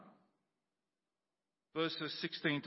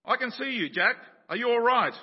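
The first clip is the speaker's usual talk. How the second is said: calling down; 8 semitones higher, 7 dB louder than usual. Acted. Voice calling down to Jack.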